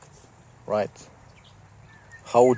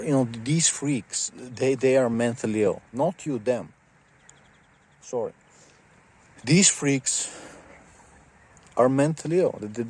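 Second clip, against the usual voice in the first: ordinary delivery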